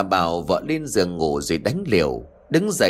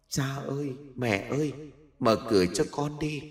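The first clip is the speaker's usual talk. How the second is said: faint, far-off ghostly whimper, much softer; ghostly wail; 4 semitones higher, 7 dB quieter than usual. Acted. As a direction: plead like a hungry spirit at the door. Ghost son pleading a third time.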